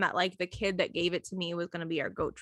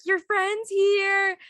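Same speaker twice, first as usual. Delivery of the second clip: high, drawn-out, sing-out call; 10 semitones higher, 5 dB louder, much slower than usual. Retold or imitated. Mom calls the student over, high drawn-out call. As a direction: call the child over, slow and loud.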